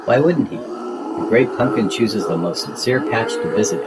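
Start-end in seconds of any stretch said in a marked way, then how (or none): none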